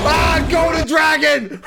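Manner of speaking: high, loud exclamation; excited shout. Real, not acted. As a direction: yell it out like a thrilled fan. Shouting at film climax.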